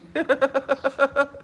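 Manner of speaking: high burst of laughter; real laugh. Real, not acted. Laughing at the idea that famous creators would talk to her. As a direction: laugh at yourself.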